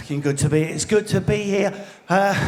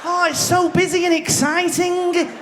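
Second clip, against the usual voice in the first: gushing day-tripper; 8 semitones higher than usual. Retold or imitated. Mimics Northerners again.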